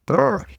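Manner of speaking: high-pitched brr; brr noise. Real, not acted. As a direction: let out a short, high brr. Playful noise before resuming.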